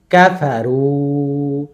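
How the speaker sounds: কাফারু with short কাফা and long held রু; stretched last syllable. Shown as a demonstration. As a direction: move through কাফা, then sustain রু. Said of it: "রুতে ওয়াও মাদ".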